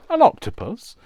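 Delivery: loud, high imitation of a friend; friend's catchphrase. Crafter quotes a friend's old saying, high loud mimicry. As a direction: call it out high like a silly catchphrase. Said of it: "a friend that used to say".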